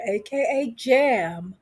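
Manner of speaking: ordinary delivery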